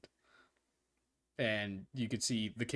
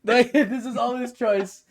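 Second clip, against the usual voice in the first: high, loud, laughing; laughing hard; 17 dB louder than usual. Real, not acted. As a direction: squeeze it out through laughter. Table laughing at staring player.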